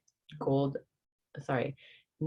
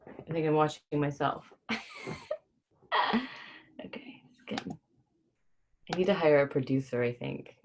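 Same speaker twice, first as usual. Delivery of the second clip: plain reading voice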